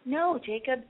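usual speaking voice

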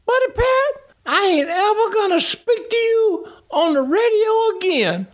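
high, pouting mock driver voice; sulky driver. Driver sulking back at crew chief over radio, high whiny pout. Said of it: "That's exactly the way he said it back to me".

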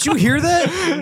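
laughing. Retold or imitated. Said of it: "I'm like".

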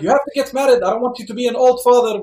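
high-pitched, hurried mock nagging; imitated nagging dad. Dad pressing son to get married, imitated nagging. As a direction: lecture him like an insistent father.

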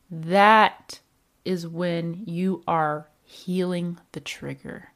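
ordinary delivery

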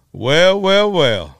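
high, loud drawn-out well well well; imitating B B King. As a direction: relish it like a pleased old bluesman. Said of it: "like that".

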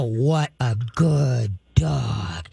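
low, very slow, menacing "What a good dog"; stern tone, good words. Shown as a demonstration. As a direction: growl the praise like a threat. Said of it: "you can come up and go".

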